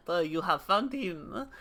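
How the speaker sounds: normal talking voice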